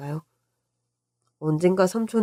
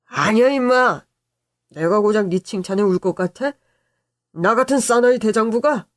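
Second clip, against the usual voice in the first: raised, indignant uncle voice; blustering denial; 3 semitones higher than usual. Acted. Uncle denying he cried at nephew's praise, blustering. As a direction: protest with mock-tough bluster.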